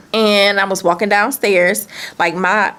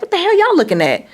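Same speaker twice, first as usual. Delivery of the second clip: angry confrontation; 11 semitones higher, faster than usual. Retold or imitated. Confronting her friend and No Good caught on the stairs, angry high challenge. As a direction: demand to know what they're staring at. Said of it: "my exact".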